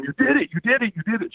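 high-pitched imitated congratulation; excited chant, pitched well up; retold cheering. Girl pulling him off the coals, high excited cheering.

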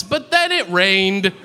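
lightly acted whiny letdown; mock lament. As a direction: stretch it out as a petty lament. Imagined Hitler laments his chalk.

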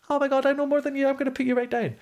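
high, gloating snob voice; mock know-it-all snob; smug gatekeeper. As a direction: lord it over them from up high. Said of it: "gatekeeping mentality of like".

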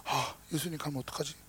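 low, subdued worried muttering; 근심하는 제자. Acted. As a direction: fret quietly under your breath, as a worried disciple. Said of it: "이 제자들은 근심해". (나직하게 걱정하는 혼잣말)

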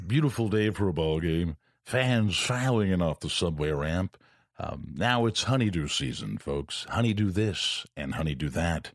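put-on low, slow old-time ballgame announcer; relaxed radio baseball announcer accent; Bob Murphy impression. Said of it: "had that accent where he'd be like".

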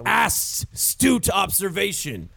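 slow, hissing ASS in astute; mock praise, slow and pointed; drawn-out punchline. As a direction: stretch astute to land the ass pun. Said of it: "He just called you an ass".

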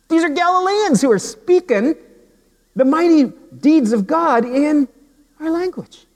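very high, incredulous crowd voice; high-pitched amazement with broken pauses; astonished. Acted. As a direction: voice an onlooker who can't believe it.

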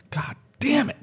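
high exasperated cry; exasperated outburst. Retold or imitated. Bandleader recalls losing it, high exasperated cry. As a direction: blow up in frustration.

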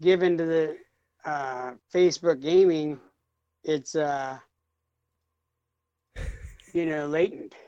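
halting, with long pauses and fillers; struggling for words. Real, not acted. Put on the spot by co-hosts, halting and unsure. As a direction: fumble along, losing the thread. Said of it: "can't get the words out".

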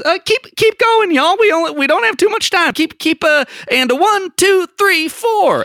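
very high stage assistant voice; high, hurried rehearsal count-in; Lily. Acted. Assistant urging performers on stage to continue, high-voiced. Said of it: "Lily turns to the people".

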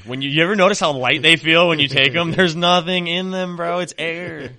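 mock confession. Call-center agent to customer about empty capsules, loud mock candour. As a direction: push it loud as if exposing a scam.

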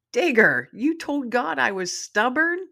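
high, incredulous question, loud on the name; mock-indignant surprise. A narrator's reading. Mom hears Digger told God she was stubborn, indignant surprise. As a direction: scold the pup in disbelief.

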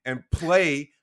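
put-on fiery old-school coach chewing out players; halftime pep speech. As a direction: yell at the team like a coach at halftime. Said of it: "like an old school coach and going".

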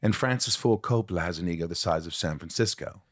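imitated English accent, opening firmly; Gary Oldman English accent. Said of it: "where would you say I'm from?".

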